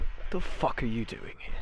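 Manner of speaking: unvoiced, whispered disbelief; baffled hushed aside. Warehouse boss looks back at silent stranger, incredulous whisper. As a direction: whisper it as a baffled double take. Foreman's baffled double take, voiced.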